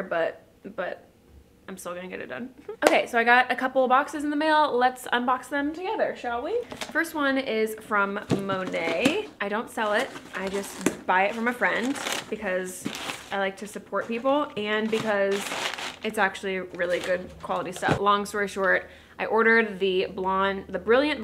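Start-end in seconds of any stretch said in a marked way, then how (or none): none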